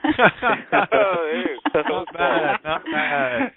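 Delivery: high, drawn-out words through laughter; laughing approval. Real, not acted. Host laughing at the caller's accent attempt, amused praise. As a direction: laugh while giving grudging praise.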